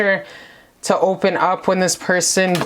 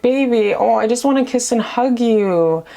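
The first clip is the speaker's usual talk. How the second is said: ordinary talk, nothing marked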